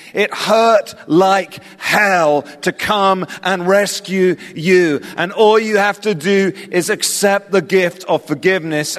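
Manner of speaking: high, emphatic sermon delivery; impassioned preaching. Real, not acted. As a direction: preach it with urgency, pitched well up. Preaches the gospel with force.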